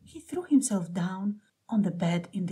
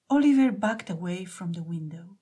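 usual speaking voice

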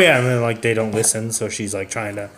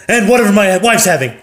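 higher, louder brash add-on; loud husband; 7 semitones higher, 5 dB louder than usual. Acted. Loud husband ordering for his wife, brash. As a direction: tack it on loudly like a blowhard. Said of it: "And then".

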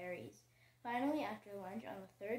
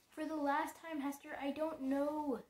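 exasperated; 6 semitones higher, 7 dB louder than usual. Acted. Exasperated reply to Hester.

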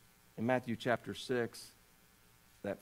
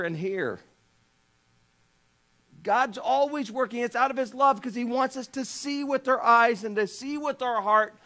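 raised, insistent preacher voice; fervent preaching; 12 semitones higher, 10 dB louder than usual. Acted. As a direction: press the point on the congregation.

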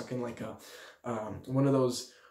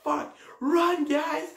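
high-pitched imitated call to flee; mock panicked warning; 12 semitones higher, much slower than usual. Firework lighter warns the crowd, mock panicked warning. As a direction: mimic a man calling out in alarm.

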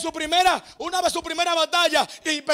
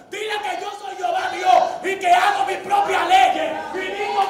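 booming proclamation; 4 semitones higher than usual. Acted. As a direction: proclaim it like God on high. God proclaims his authority.

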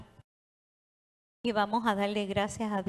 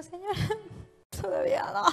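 high, laughing mock shout to the Lord; laughing plea; 11 semitones higher than usual. Preacher jokingly tells the Lord not yet, laughing high shout. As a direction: playfully cry out not yet, much higher and laughing. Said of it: "yo le digo".

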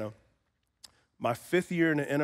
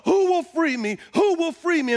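high, loud repeated plea; Paul's cry of need; 12 semitones higher, 6 dB louder than usual. Acted. Paul asks who will free him, pleading cry. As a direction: call out urgently for rescue. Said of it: "it's a cry of need".

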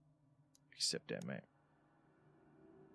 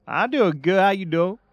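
much higher, louder eager candidate voice; mock job candidate; 9 semitones higher, 10 dB louder than usual. Playing Ernesto answering the interview call, high and loud. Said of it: "you better talk like this".

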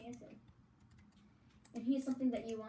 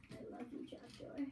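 soft unvoiced whisper; 3 semitones higher, 8 dB quieter than usual. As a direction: breathe it like a secret. Whispers love to trapdoor.

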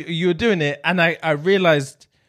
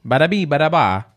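mock Italian gibberish. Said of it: "Italiano, eh?".

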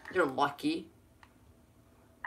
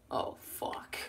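whispered curse; 13 dB quieter than usual. Real, not acted. Under-breath curse in game.

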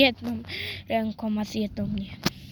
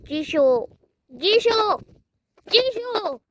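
calling out; 6 semitones higher, 3 dB louder than usual. Pretend. Searching for the lost toy.

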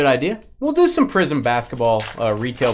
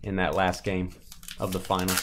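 usual speaking voice

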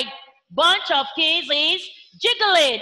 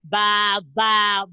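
low sheep bleat; 7 semitones lower than usual. Shown as a demonstration. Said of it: "A sheep baa".

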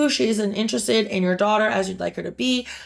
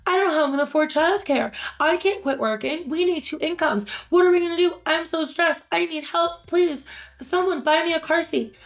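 higher, fast panicky whining; mock stressed new mom; 7 semitones higher than usual. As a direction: parody a frazzled mom begging for help. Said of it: "they're like".

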